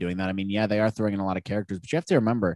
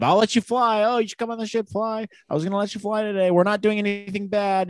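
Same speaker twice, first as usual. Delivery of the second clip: much higher, coaxing sly voice; tempting uncle; 10 semitones higher than usual. Acted. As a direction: lure the kid onto the ship with a high wheedling voice. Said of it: "tempting her to get on the ship".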